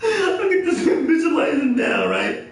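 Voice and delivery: barely getting it out for laughing; talking through a laughing fit. Real, not acted. Laughing while picturing it.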